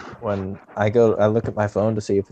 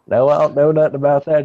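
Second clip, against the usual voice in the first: louder, higher clueless bumpkin act; 6 semitones higher, 6 dB louder than usual. Retold or imitated. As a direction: play dumb like a country caller. Acts clueless hick to scammer.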